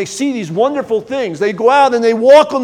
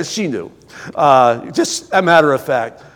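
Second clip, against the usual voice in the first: usual speaking voice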